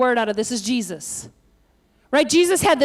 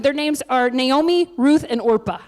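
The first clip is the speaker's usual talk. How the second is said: normal talking voice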